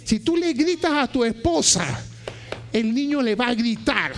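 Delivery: usual speaking voice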